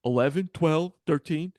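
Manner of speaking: a lightly voiced bratty kid; mimicking the kids. Teacher imitating middle schoolers answering their ages, bratty. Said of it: "They're like".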